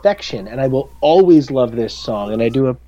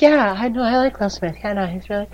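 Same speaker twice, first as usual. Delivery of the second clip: higher, softer, noncommittal voice; mock focus group member; 6 semitones higher, 6 dB quieter than usual. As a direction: act like a bored test viewer. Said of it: "everybody's like".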